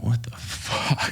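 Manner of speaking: slow, breathless whispered disbelief; shocked whisper. Retold or imitated. Retelling his reaction to the French officer, whispering.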